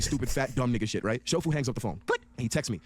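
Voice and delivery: ordinary talk, nothing marked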